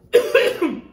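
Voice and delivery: sharp spicy cough; coughing fit. Real, not acted. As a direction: cough from the burn.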